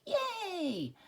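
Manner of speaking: high joyful squeal; happy cheer. Acted. The pentagon cheers after learning his perimeter. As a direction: cheer like you just won.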